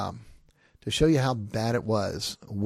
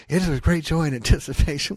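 loud mock recitation of the pulpit greeting; mimicking preacher, louder; teens mocking the pastor; 4 semitones higher, 6 dB louder than usual. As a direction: chant it along like bored teenagers mocking. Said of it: "we'd all quote it with him".

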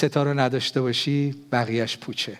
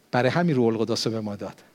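usual speaking voice

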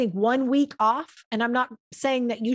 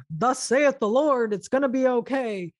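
lightly hammed-up pompous oracle; a hint of a thundering preacher; playfully voicing God as a grand proclaimer; mock prophet voice. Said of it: "he's like".

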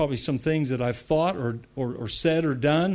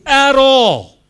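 loud, fervent preaching, much louder; preaching at full force; 7 semitones higher, 11 dB louder than usual. Acted. Pastor declaring to the congregation, loud fervent preaching. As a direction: thunder it out to the congregation.

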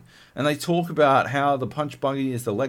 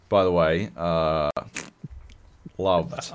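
ordinary delivery

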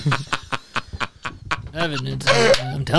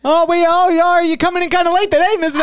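bright, higher voice greeting a celebrity; fawning doorman, pitched up; eager doorman; 6 semitones higher than usual. Acted. Doorman greeting a star at the door, fawning and high.

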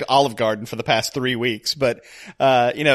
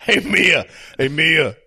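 lightly voiced movie tough guy; a half-hearted character impression; Dom; slower than usual. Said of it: "I'm doing Dom's voice".

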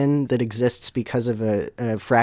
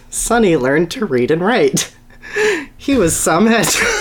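reading the absurd line aloud and breaking up; laughing while reading; 9 semitones higher, 5 dB louder than usual. Real, not acted. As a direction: voice breaking into laughter, much higher. Cracking up mid-read.

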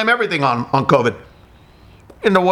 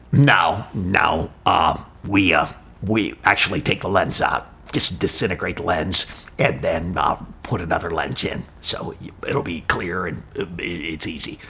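doctor; 10 semitones lower, 6 dB quieter than usual. Retold or imitated. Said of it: "And he goes".

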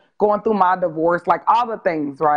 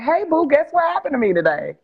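higher, cooing and coy; sweet teasing greeting; 8 semitones higher than usual. Retold or imitated. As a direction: tease him sweetly before the news. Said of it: "I was like".

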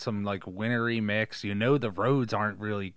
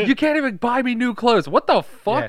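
very high, loud shrill complaining; shrill nagging wife; 14 semitones higher, 9 dB louder than usual. Pretend. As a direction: shriek like a spoiled wife. Mock wife rant.

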